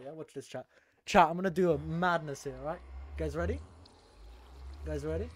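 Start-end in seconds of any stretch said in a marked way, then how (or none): 0.0-0.7 quiet. Real, not acted. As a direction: drop to a near whisper. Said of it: "Watch this, watch this, chat".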